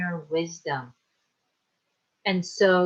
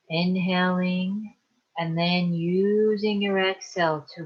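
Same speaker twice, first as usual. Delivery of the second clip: calm, unhurried meditation guide with long pauses; guided meditation. Acted. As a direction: ease them into relaxation, leaving space to breathe. Leads a guided relaxation meditation.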